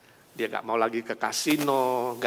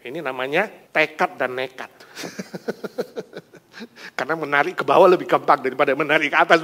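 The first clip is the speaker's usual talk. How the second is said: ordinary talk, nothing marked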